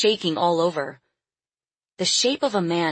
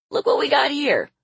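much higher, gleeful showing-off; grinning old man; 10 semitones higher, 4 dB louder than usual. A narrator's reading. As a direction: show off a find, pitch leaping up. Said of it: "Leon pointed at Lily and grinned".

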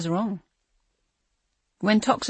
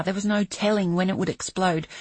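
plain reading voice

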